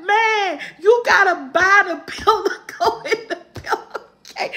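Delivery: can't stop laughing. Real, not acted. Telling her son to buy the pillow, laughing.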